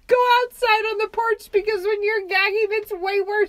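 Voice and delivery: laughing retold order. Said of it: "So Ben's like".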